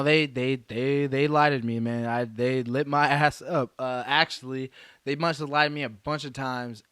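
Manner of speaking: ordinary talk, nothing marked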